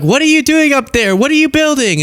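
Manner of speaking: high, shouted reporter questions; mock reporters calling up from below. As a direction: play reporters yelling up at the roof. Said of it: "They're like".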